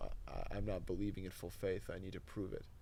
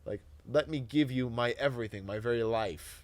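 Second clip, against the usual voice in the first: militaristic vow; 3 semitones higher, 8 dB louder than usual. Retold or imitated. Retold militaristic martyr prayer.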